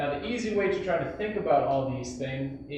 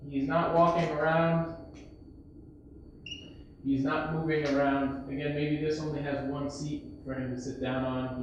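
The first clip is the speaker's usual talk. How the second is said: normal talking voice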